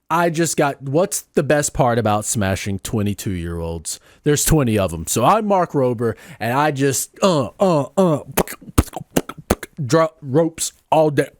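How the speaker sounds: normal talking voice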